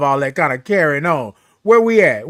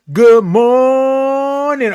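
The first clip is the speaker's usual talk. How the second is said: high, slow sung-out greeting; drawn-out morning greeting; 7 semitones higher, 4 dB louder, much slower than usual. Real, not acted. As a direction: hold morning on one long note. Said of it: "the way that I say it".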